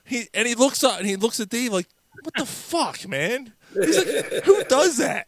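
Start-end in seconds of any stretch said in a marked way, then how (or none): 2.2-5.3 very high mimicked outrage; incredulous mock protest, rising to loud; appalled disbelief. Appalled driver sees roach with heart.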